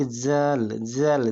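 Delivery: जल slow, held on the vowel; isolated syllable. Shown as a demonstration. Teacher showing the second syllable, जल sounded out slowly. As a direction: draw out जल by itself. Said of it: "जल पे एक आकार".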